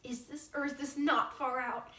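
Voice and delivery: bubbly, over-the-top airhead; ditzy teen girl. Acted. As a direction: gush like a vain new class president.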